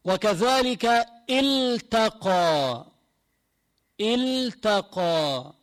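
very slow, drawn-out words; slow recitation demo. Shown as a demonstration. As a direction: say التقى slowly for students. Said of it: "slow demo of word". (تلاوة بطيئة للتوضيح)

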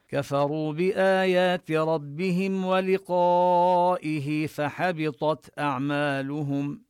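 teacher reciting the verse as a model; Quran recitation. Shown as a demonstration. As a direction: chant the verse out slowly and fully. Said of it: "teacher recites the verse".

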